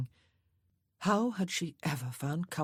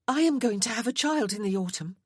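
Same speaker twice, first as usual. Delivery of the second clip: sudden, louder and higher, it bursts out of her; blurted out; 6 semitones higher, 6 dB louder than usual. A narrator's reading. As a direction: blurt the news out before she can stop. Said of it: "Agnes blurted".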